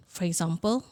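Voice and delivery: long breathy demo sigh; audible sigh. Shown as a demonstration. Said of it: "I want you to sigh".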